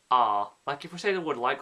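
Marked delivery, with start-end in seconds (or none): 0.1-0.5 I in Yorkshire sounds like R; Yorkshire accent. Shown as a demonstration. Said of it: "sometimes will say".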